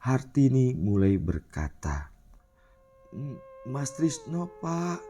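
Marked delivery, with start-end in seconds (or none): 3.1-5.0 slow, soft, high tearful answer; sobbing, stammering. A narrator's reading. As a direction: falter through tears, barely getting it out. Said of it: "Dengan tangis yang terisak dan terbata-bata".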